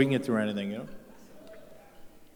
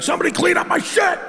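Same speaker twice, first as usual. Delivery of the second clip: panicked shout. Retold or imitated. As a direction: scream for help like a coward. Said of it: "I'd be like".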